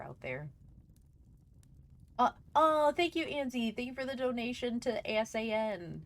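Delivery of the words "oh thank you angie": normal talking voice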